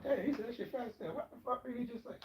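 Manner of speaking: high, hushed, confused mutter; puzzled listener under his breath; baffled listener. Retold or imitated. Viewer confused by his fast talk, high and hushed. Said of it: "you probably say to yourself".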